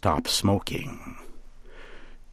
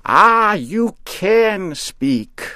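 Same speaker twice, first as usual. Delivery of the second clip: slow, much higher gleeful witch; delighted witch; 13 semitones higher, 6 dB louder, much slower than usual. A narrator's reading. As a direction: marvel at him slowly.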